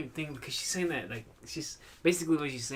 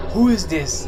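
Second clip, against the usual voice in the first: loud, demanding Liam Neeson growl; hammed-up action hero demanding an answer; tough Liam Neeson; 4 semitones higher, 13 dB louder, slower than usual. Imitating Liam Neeson answering the scam caller. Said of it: "kinda like fucking, what's his face from".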